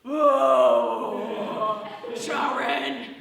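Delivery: loud slurred [name] shout; [name] impression. To the professor, an [name] impression shouted loud. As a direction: bellow it like [name] calling his wife. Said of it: "the first one is [name]".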